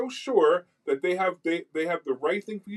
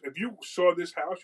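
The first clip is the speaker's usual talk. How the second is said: ordinary talk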